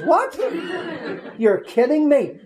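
high-pitched incredulous "what", retold; astonished disbelief. Customer to mechanic on hearing of the found camcorder, incredulous. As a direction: react in comic disbelief, voice jumping up.

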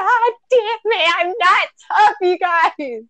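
high, teary self-scolding; half-laughing through imitated tears; mock crying at herself at work; retold tearful frustration. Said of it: "tears just fall out of my eyes".

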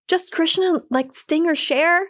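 high, bright imitation of Ram Dass's reply; playful high-pitched impression of an old guru; high mock voice. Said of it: "And he's like".

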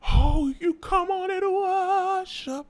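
high, slow, stretched call. Retold or imitated. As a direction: draw it out high like a church leader.

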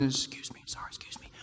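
hushed apologetic whisper; sneaking, sorry-sorry whisper. Pretend. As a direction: whisper it as if squeezing past seats. Slipping past passengers to cockpit.

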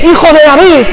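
high, loud cry of faith; shouted plea. Retold or imitated. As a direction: shout it with everything you have. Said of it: "y grita y dice".

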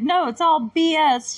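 loud, high, slow mock outburst; retold arguing. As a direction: throw it back loudly, stretching the words. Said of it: "like, arguing".

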